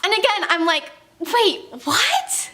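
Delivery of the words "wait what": surprised. Retold or imitated. Driver's mom lives nearby, surprise.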